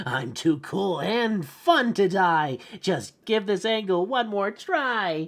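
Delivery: high-pitched put-on cartoon character voice; high, theatrical rhyming plea; Looney. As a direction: plead your case in a high character voice. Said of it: "I'll just do it in Looney's voice".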